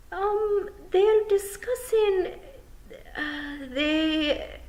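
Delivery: very high, slow, halting pixie; can't bring himself to say it; embarrassed stammer; stammering shy pixie. Acted.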